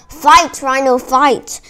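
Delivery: rousing storyteller's shout; yelled urging. A narrator's reading. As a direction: urge on your fighter. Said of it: "I yelled".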